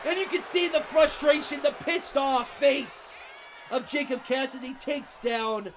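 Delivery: ordinary talk, nothing marked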